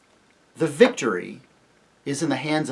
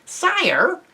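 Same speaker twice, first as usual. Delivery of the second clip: shrill, high little mouse voice; small, formal creature calling up; Reepicheep; 5 dB louder than usual. A narrator's reading. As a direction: address the king in a high, loud pipe. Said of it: "came a shrill voice".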